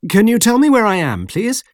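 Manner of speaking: high-pitched, rising polite question; polite appeal. A narrator's reading. Lost sailor asks locals where he is, high polite appeal. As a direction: ask in a lifted, pleading tone.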